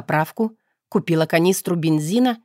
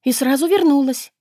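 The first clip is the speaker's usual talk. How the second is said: plaintive; 5 semitones higher, 4 dB louder than usual. A narrator's reading. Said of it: "добавила жалобно".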